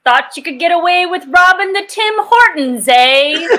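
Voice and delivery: Canadian Mountie with a lilting "eh"; Canadian accent. Acted. Player as a Mountie catching a robber, Canadian accent. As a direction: put on a playful Canadian accent. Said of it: "simply for the Canadian accents".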